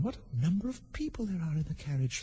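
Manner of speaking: put-on soft, high girl's voice; light inner voice of Alice; Alice thinking. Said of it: "thought Alice".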